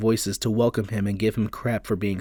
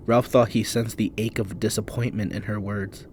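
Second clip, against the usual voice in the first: ordinary delivery, nothing marked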